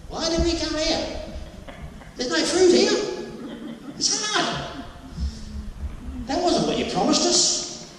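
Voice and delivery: high, complaining servant voice, laughing on the fruit; whining servants. Retold or imitated. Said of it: "Imagine all the servants saying".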